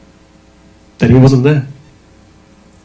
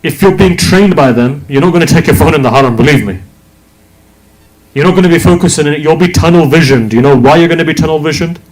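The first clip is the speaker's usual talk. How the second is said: impassioned preaching; 4 semitones higher than usual. Real, not acted. Preacher builds intensity.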